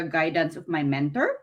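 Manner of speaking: ordinary delivery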